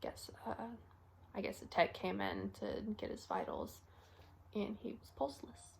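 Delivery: hushed, halting account of a death; quiet, reluctant confession; subdued grief. Acted. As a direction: speak softly, with long heavy pauses. Acted recollection of finding patient dead.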